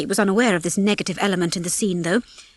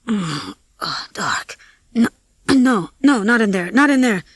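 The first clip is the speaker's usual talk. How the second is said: groggy moan rising into broken, agitated fragments; delirious mumbling; 3 semitones higher, 3 dB louder than usual. A narrator's reading. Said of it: "he mumbled deliriously".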